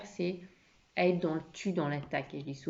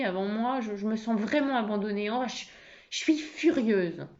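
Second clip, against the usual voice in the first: higher, hurt and furious; non-violent anger; 4 semitones higher than usual. Acted. Wife feeling abandoned by husband, angry without attacking. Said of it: "La colère non violente".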